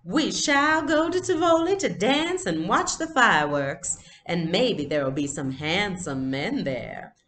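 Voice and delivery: lightly voiced lady doll, high and grand at first; a hint of a grand doll lady; mild play-acting doll voice. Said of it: "using her doll voice".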